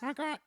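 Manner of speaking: high playful honk, honk; squeaky mock horn sound; silly clown honk.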